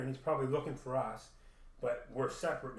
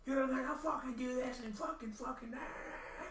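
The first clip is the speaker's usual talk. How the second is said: imitating angry Haley; 12 semitones higher than usual. Haley ranting at him in the street, high mock rage. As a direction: ham up her high rant, then let it fade.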